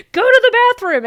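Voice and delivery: put-on sudden high, loud shout at a two-year-old; exasperated parent shouting at a potty-training toddler; yelling at toddler. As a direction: snap a loud order at a small child. Retelling yelling at her toddler.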